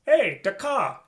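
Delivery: Boston "car" as a broad "kah" without the r; Boston accent. Retold or imitated. Teacher imitating a Boston speaker, Boston accent. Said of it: "if you're from Boston and you're saying the car".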